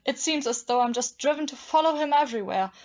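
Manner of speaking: ordinary talk, nothing marked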